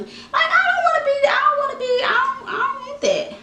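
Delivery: high, fast, whiny mock-crying voice; whiny high protest. Vlogger whining about her imagined wedding day, whiny and high. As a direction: whine it like a wounded bride, fast and high.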